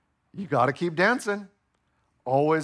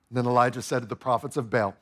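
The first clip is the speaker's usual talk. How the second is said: normal talking voice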